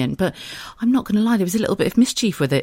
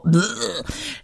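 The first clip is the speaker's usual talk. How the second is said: disgusted bleh; 6 semitones higher than usual. Retold or imitated. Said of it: "Right now you're either going".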